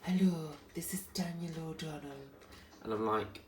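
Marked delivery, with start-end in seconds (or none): 0.0-2.2 light imitation of a singer's greeting; lightly voiced celebrity hello. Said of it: "he went".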